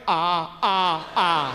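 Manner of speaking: "ah, ah, ah" as three separate drawn-out open vowels; sounding out letters. Retold or imitated. Father misreads the battery size, sounding out "ah, ah, ah". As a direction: read AAA as "ah, ah, ah". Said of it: "Did he just sound out triple A".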